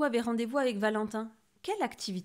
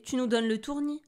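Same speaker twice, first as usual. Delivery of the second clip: higher, louder exasperated complaint; 4 semitones higher, 6 dB louder than usual. A narrator's reading. Exasperated owl master complaining to restless Lou. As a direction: complain like a teacher at the end of patience. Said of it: "maître hibou excédé".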